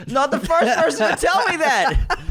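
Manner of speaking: laughing. Real, not acted. Laughing at roast.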